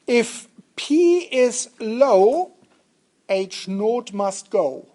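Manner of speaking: very slow, deliberate delivery with pauses; slow mnemonic. Shown as a demonstration. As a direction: spell out the rhyme for the class, unhurried. Reciting a mnemonic for students.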